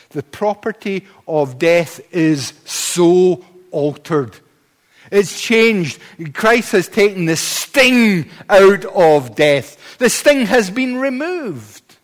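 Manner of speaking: slow, weighty pulpit proclamation; emphatic preaching. Acted. Preacher proclaiming the sting of death removed, emphatic preaching. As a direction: stretch the key words with conviction.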